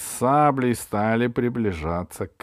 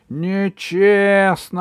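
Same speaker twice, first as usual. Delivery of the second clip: high, drawn-out protest shout; 10 semitones higher, 8 dB louder, much slower than usual. A narrator's reading. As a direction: cry foul, stretching "Нечестно". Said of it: "закричали караванщики". (протяжный крик протеста)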